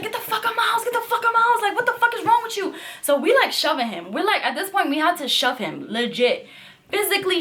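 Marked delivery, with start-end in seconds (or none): 0.0-2.7 high shrill mock yell of the roommate. Roommate yelling at the guest to leave, high mock yell. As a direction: scream him out of the house, pitched way up. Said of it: "She's like".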